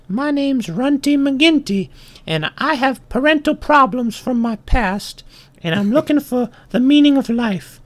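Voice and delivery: very high little ant voice; Runty McGinty. Acted. Ant introducing himself to the bull, high little voice. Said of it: "so the ant goes and he says".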